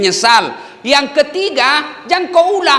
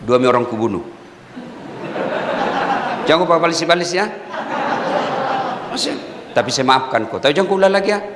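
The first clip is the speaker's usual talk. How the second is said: lower-pitched, lightly voiced tough prisoner; half-joking threat from a convict; thug prisoner; 8 semitones lower, 3 dB quieter, faster than usual.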